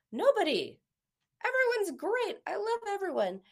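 high, sing-song everything's fine voice; imitating a cheery client insisting she loves everyone; bright fake denial. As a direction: brush it off like nothing's wrong. Client denies being mad at anyone.

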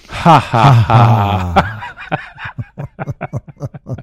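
loud ha ha then a breathy laughing ha; three laughing ha's. Shown as a demonstration. Laughter coach demonstrating three ha's for the audience, laughing. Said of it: "give me three ha's on this one".

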